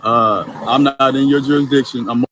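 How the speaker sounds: speaking up. Real, not acted. Driver raising voice on phone.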